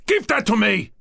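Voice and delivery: high, loud furious demand; snatch it back in rage; furious priest grabbing the diamond; apoplectic. Acted. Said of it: "he was apoplectic now".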